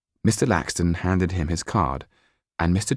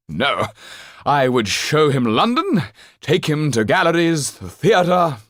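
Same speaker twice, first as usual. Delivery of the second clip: much higher and louder, grand stage manner; theatrical old actor; 7 semitones higher, 4 dB louder than usual. Acted. Actor Trippin telling the banker about the boy, theatrical old actor. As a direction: declaim like a hammy actor. Trippin enthusing, high loud.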